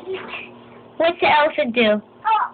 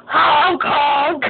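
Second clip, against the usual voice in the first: giggly nose honk, a bit louder; laughing honk; 3 semitones higher, 3 dB louder than usual. Shown as a demonstration. Parent playing nose game with baby, laughing honk. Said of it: "What's a nose say?".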